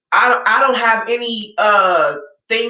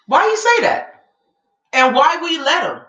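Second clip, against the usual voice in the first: outraged; 5 semitones higher than usual. Real, not acted. Outraged at the quote.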